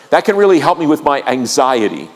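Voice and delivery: usual speaking voice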